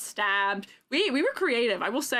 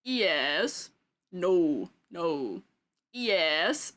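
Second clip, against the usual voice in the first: Talking Ben voice; 5 semitones lower, much slower than usual. Retold or imitated. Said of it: "the dog one where he goes".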